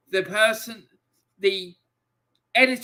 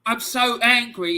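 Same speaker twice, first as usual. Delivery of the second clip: higher and louder, near tears with fury; real anger; 6 semitones higher, 4 dB louder than usual. Real, not acted. Streamer telling viewers how Hillsborough disrespect feels, raw anger. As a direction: push the anger out, close to breaking. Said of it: "it's making me wanna cry".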